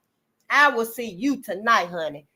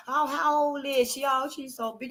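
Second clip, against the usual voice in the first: mock cooing; 3 semitones higher, 6 dB quieter than usual. Mocking fussy onlooker.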